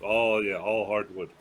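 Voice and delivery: normal talking voice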